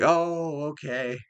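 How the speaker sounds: big impressed start, then settle; pleasantly surprised. Pretend. Fan reacting to Superman fighting Godzilla, pleasantly surprised.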